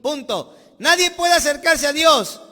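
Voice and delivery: loud, fervent sermon build; full-force preaching. Acted. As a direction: preach it out to the whole church.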